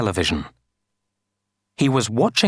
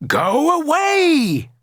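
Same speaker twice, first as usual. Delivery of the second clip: high, drawn-out storybook yell; 3 dB louder, much slower than usual. A narrator's reading. Villagers driving off a giant, yelling. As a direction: yell it out like an angry crowd. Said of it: "they yelled".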